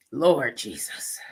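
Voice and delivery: breathy exasperated whisper, slow; whispered Lord Jesus, worn out; whispered sigh. Real, not acted. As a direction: let it out under your breath.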